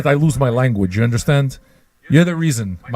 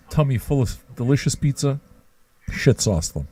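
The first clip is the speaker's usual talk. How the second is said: ordinary talk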